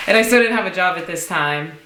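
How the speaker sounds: normal talking voice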